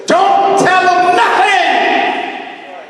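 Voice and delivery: loud, high, slow shout; loud slow drawn-out yell; shouted warning. Real, not acted. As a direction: slam the warning home. Emphatic warning to audience.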